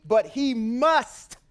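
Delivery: "must" drawn out slowly and weighted; slow down and hammer "must"; stress on must. Shown as a demonstration. Preacher stressing the law to his listener, slow and weighty on "must". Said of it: "I don't want to emphasize that word".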